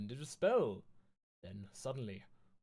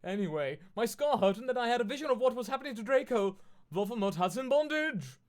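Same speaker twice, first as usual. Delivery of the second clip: put-on higher, louder girlish goth voice for the heroine; mock Ebony voice; 9 semitones higher, 6 dB louder than usual. Streamer reading Ebony's fanfic lines aloud, hammed-up girlish voice. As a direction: ham up the whiny narrator girl.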